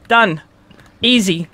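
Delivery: ordinary delivery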